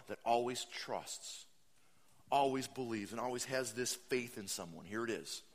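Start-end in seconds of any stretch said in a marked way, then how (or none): none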